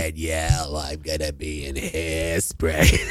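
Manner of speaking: low, slow, raspy Broadway voice; Harvey Fierstein. Pretend. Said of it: "just making it more like Harvey S".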